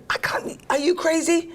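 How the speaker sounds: 2 high breathy whisper of disbelief; 3 gasp it out in panic; 1 panicked whisper. Retold or imitated. 4 refusing husband's plan, whispered panic.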